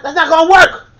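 sudden loud high outburst; exclaiming. Real, not acted. Telling listeners the polite plan fails, loud outburst. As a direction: blurt out the objection loudly.

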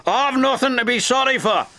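high, indignant retort; very cross. A narrator's reading. Douglas refuses to apologise to his twin, cross. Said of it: "made Douglas very cross".

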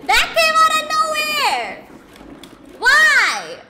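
startled yell. Real, not acted. Startled by sudden enemy.

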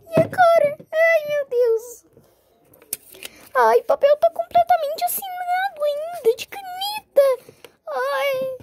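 high moaning lament; wailing. Acted. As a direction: wail over the signed paper. Wails over the job.

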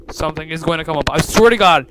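loud, fast, building to a yell; panicked. Real, not acted. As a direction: rush it in mounting alarm. Dreading an imminent jump scare.

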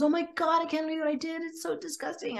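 high-pitched, distraught self-reproach; upset and disgusted. Retold or imitated. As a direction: sound appalled at yourself, pitch up. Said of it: "just so upset with herself".

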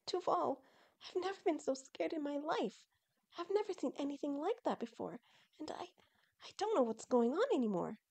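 high voice dropping into a whisper; falter, overwhelmed after a scare; shaken fear. Acted. Mother shaken, embracing her son.

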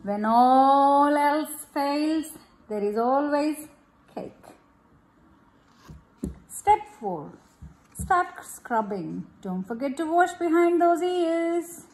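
gentle storybook reading, easy pace; reading to children. Acted. Mother reading a bath-time picture book to her child, gentle storybook voice. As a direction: read it aloud to a little one.

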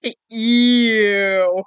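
very loud, long mock ew; exaggerated copy of a disgusted ew; imitated "ew". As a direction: belt out her ew, stretched. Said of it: "All the way she went".

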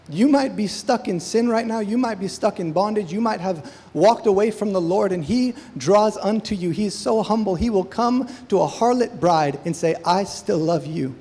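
loud, impassioned sermon delivery; preaching at full force. Acted. Pastor urging his congregation, preaching at full force. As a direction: preach it out with fire to the church.

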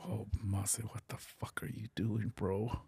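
hushed panicked whisper; whispering to himself in fear; scared whisper to himself. Retold or imitated. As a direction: whisper it, scolding yourself. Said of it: "this is where I'm starting to fucking go".

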